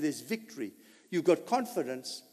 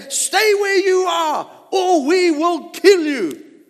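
higher, louder, slow shouted threat; mock Philistine threat; 13 semitones higher, 12 dB louder, slower than usual. Preacher shouting the Philistines' threat, slow and loud. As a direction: bellow it down the hill as the enemy.

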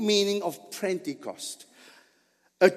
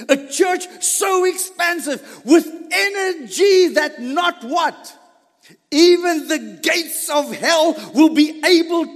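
loud, high, building fervor; full force preaching; 6 semitones higher, 5 dB louder than usual. Acted. Pastor rousing the Pentecost congregation in loud preaching. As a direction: preach it to the rafters.

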